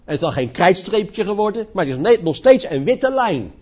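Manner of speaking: ordinary talk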